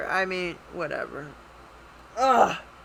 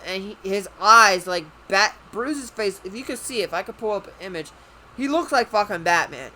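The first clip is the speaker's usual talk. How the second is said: ordinary talk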